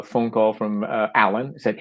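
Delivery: usual speaking voice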